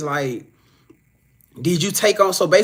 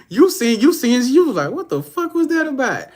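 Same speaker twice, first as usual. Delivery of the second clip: high, loud disbelieving quote; mock shocked reaction to a red flag; incredulous; 7 semitones higher, 3 dB louder than usual. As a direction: play it as stunned disbelief. Acted quote, rising disbelief.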